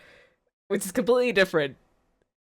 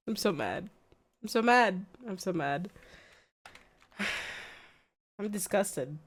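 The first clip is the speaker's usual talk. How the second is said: quiet seething; 7 dB quieter than usual. Real, not acted. Muttering after the news.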